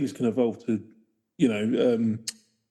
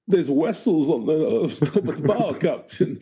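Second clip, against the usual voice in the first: slow, higher mock Russian sailor; mock Russian accent; 5 semitones higher than usual. Co-host joins in on the voice, mock Russian accent. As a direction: put on a comic Russian accent.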